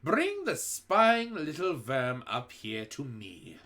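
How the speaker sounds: slow, sinister witch voice; gloating witch. A narrator's reading. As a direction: relish the catch. Grand High Witch.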